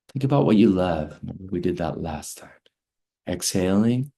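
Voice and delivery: calm teacher guiding a breathing exercise; guided breathwork. Acted. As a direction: lead them gently through each breath.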